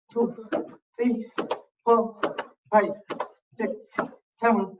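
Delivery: high, punchy counting of each rep; workout count. Acted. Fitness coach counting reps for the class, high and punchy. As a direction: call out the reps to keep the class moving.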